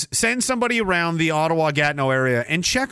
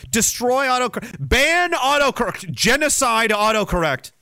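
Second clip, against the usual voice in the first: mock rant; 6 semitones higher than usual. Real, not acted. Ranting call to ban autocorrect.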